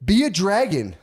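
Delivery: put-on high, loud child's voice; high-pitched kiddie demand; demanding little kid. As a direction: mimic an eager kid calling out an order. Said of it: "They FaceTime you".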